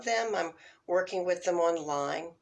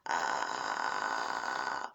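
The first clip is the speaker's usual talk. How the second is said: deep crackling fry on ah; vocal fry; 4 dB quieter than usual. Retold or imitated. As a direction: let the ah crackle low and loose. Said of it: "Maxine used to do this all the time".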